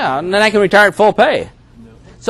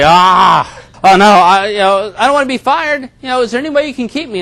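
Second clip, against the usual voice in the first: higher, faster, pleading to keep the job; panicked pleading; 5 semitones higher, 3 dB louder than usual. Retold or imitated. Employee begging the boss not to fire him, panicked. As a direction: beg the boss in a rush of panic. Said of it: "And now you panic".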